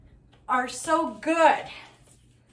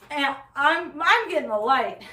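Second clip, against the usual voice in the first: ordinary delivery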